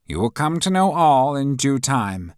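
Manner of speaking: high, loud fussy professor voice; pompous professor taking charge; stern professor. A narrator's reading. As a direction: announce it like a pompous teacher.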